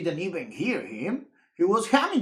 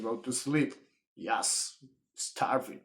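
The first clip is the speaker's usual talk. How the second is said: ordinary delivery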